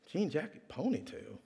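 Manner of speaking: acted quiet bewilderment of a guard; puzzled musing. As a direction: think aloud under your breath, baffled. Guard puzzling over the description.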